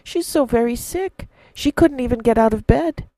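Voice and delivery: near tears. Acted. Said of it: "big tears welling up in his eyes".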